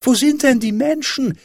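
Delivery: put-on very high child's call; high, insistent little boy calling out. A narrator's reading. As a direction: call the question up to a tower. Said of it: "rief der kleine Häwelmann hinauf".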